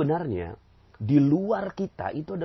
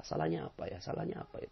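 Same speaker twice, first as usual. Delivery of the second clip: a hushed inward question; whispered self-questioning; 5 semitones lower, 10 dB quieter, faster than usual. Shown as a demonstration. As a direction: whisper it, asking yourself.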